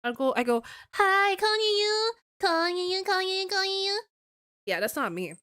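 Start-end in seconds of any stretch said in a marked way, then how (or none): 0.9-3.9 very high, drawn-out cutesy greeting; put-on high sing-song hello; higher pitched. Shown as a demonstration. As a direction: exaggerate a sugary high stream voice. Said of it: "this habit of going higher pitched".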